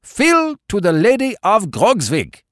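high, declaimed Baron's toast; crying a toast. A narrator's reading. Baron calls his men to drink, high crying toast. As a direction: call out the toast high and commanding. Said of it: "cried the Baron suddenly".

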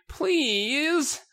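very high, drawn-out plea; pleading. Acted. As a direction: beg in a piping, hammed-up voice. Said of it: "you plead".

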